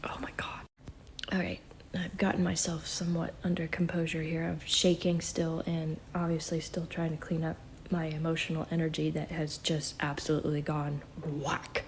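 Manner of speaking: hushed, breathy recovery after a shock; shaken whisper. Real, not acted. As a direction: whisper it, still trembling. Shaken after overwhelming experience.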